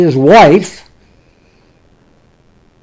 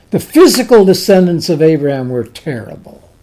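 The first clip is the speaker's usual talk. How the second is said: usual speaking voice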